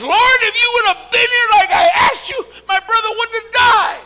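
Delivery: high, full-volume shriek of reproach; livid blaming outburst. Acted. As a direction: wail the accusation at the top of your voice. Martha angrily blaming the Lord.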